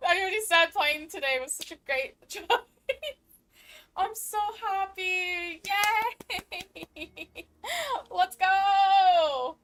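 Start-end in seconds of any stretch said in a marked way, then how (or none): 4.0-9.6 high, slow, laughing happiness; laughing joy. Real, not acted. Streamer still laughing with delight at the news. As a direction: giggle through the joy.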